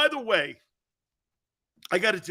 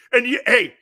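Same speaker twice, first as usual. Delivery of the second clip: ordinary talk, nothing marked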